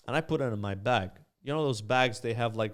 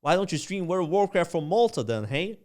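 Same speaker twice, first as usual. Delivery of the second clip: brash American border guard, higher and louder; mock American border guard; 4 semitones higher, 5 dB louder than usual. Imitating the American border officer grilling a traveler. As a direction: play the gruff American agent questioning him. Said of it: "That accent work is incredible".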